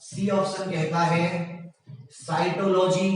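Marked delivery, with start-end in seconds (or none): none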